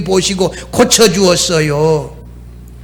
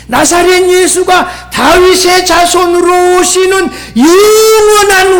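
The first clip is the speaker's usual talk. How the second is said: high, drawn-out impassioned plea; ringing, imploring proclamation; crying out in faith; 9 semitones higher, slower than usual. Retold or imitated. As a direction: call on Jesus with a preacher's full fervor. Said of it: "이렇게 소리친 것이에요".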